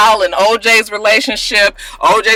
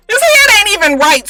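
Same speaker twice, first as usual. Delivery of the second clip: incredulous; 14 semitones higher, 5 dB louder than usual. Real, not acted. Mocking Cuba's small head.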